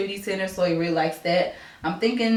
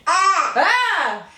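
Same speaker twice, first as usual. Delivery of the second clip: high laughing yelps, a bit louder; playful shrieks; 9 semitones higher, 4 dB louder than usual. Real, not acted. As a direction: squeal and laugh as he lunges. Baby grabbing her face.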